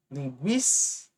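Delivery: Linguístico pronunciado devagar, marcando a sílaba guís; stressed syllable shown. Shown as a demonstration. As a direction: arraste Linguístico destacando guís. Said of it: "quando a antepenúltima for a mais forte". (pronúncia lenta de Linguístico, antepenúltima forte)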